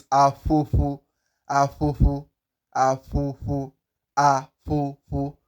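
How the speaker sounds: afofo with puffs of air between both lips; afofo, each f blown loosely through the lips; correct ph pronunciation. Shown as a demonstration. Teacher drilling the word, correct ph pronunciation. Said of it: "squeeze air through your lips".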